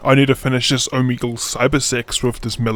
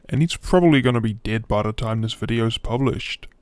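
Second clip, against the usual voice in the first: normal talking voice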